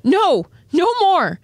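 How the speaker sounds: high, drawn-out calling refusal; acted high-pitched protest; emphatic refusal. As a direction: call out a slow, firm no. Krista refuses, calling back to Connie.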